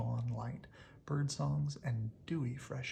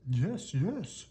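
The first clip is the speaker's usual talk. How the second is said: loud eager yes; shouted; 3 semitones higher, 5 dB louder than usual. Acted. Grump cheers the monster on, loud and eager. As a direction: shout it out with glee. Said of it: "shouted Grump".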